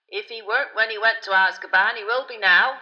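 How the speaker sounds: loud, heavy, ponderous driver; slow-voiced driver. A narrator's reading. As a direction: pronounce it ponderously as old Ern. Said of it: "said Ern in his slow voice".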